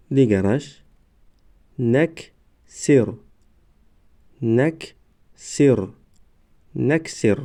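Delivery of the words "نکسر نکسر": slow, syllables held and separated; slow syllable reading. Shown as a demonstration. Teacher models first readings for learners, slow syllable by syllable. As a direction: sound it out for learners, pausing between syllables. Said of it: "بار یکم و دویم آهسته".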